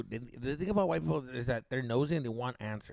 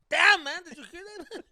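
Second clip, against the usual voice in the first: high-pitched mock dad voice; imitating dad; 14 semitones higher, 8 dB louder than usual. Friend mocks his buddy's dad reacting to the blast, high mock voice. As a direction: put on a squeaky dad voice. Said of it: "He was like".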